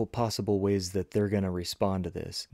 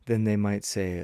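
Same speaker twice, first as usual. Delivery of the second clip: ordinary delivery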